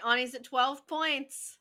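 ordinary talk, nothing marked

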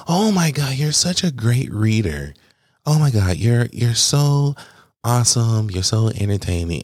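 high, slowed, drawn-out praise; gushing fan. Retold or imitated. A coworker praising his reading, high and gushing. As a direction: gush like a starstruck fan.

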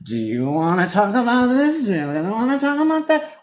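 a hint of therapist-like prodding; imitating Meredith's probing. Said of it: "With Meredith, it's like".

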